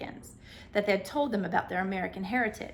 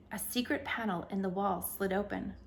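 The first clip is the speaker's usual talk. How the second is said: ordinary delivery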